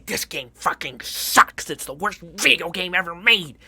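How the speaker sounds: loud, slow, over-the-top hate; exaggerated shouting gamer rant; mock hyperbolic rant. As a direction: ham up a fake outrage for the camera. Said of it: "when I say".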